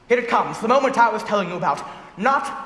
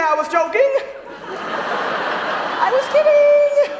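panicked backpedal. Retold or imitated. Groom at the altar to his tearful bride, panicked laughing retreat. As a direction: squeal it out like a trapped groom.

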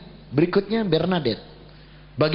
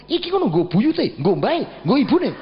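put-on old father's voice, much higher, a little slow; father-in-law voice; 8 semitones higher, 4 dB louder than usual. Father-in-law telling his son-in-law the food is for ancestors, higher put-on voice. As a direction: explain it like a stubborn old villager.

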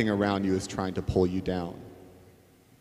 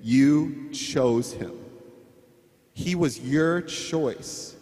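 slow, deliberate counsel; patient friend urging reconciliation; wise friend; much slower than usual. Acted. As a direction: spell it out slowly and firmly. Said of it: "girlfriends that are gonna say".

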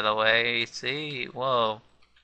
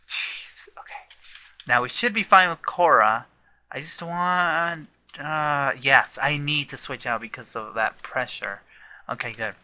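ordinary talk, nothing marked

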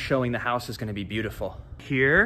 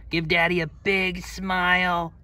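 coaxing dog; 6 semitones higher, slower than usual. Real, not acted.

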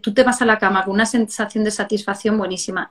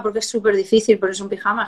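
ordinary talk

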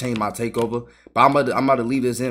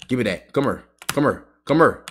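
ordinary talk, nothing marked

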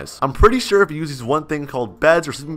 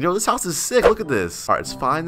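ordinary talk

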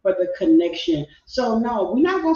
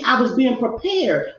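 ordinary delivery

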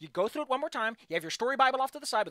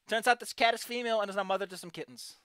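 ordinary delivery

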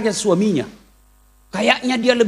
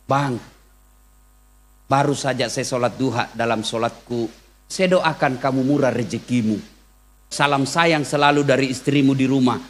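tender wife's message; 8 semitones lower, 4 dB quieter than usual. Retold or imitated. Devoted wife sending husband a tender morning message. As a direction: lower and softer, sweetly devoted. Loving wife's WhatsApp voiced softly.

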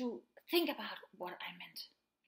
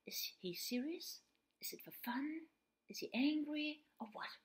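soft, questioning wondering; puzzled inner thought; 3 semitones higher, 7 dB quieter than usual. Retold or imitated. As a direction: wonder to yourself, hushed. Voices a puzzled listener's thoughts.

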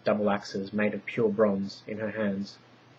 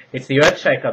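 loud, higher alarmed scream; 5 semitones higher, 12 dB louder than usual. A narrator's reading. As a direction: scream a warning as the ground splits. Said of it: "the wise one screamed".

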